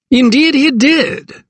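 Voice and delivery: high, slow, self-satisfied gentleman; smug magistrate. A narrator's reading. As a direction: savour the words like a pleased magistrate. Magistrate's voice, Sir Arthur.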